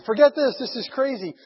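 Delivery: fed-up outburst. Retold or imitated. Missionary gives up in frustration.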